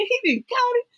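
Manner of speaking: high, stunned disbelief; shocked disbelief. Retold or imitated. In the bar, reacting to Trump's win with high shocked disbelief. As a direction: pitch it way up in shock. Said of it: "I was like".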